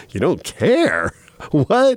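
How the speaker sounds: acting out stunned disbelief; drawn-out, incredulous retold reaction; taken aback. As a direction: play being thrown by the answer. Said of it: "I was totally taken aback".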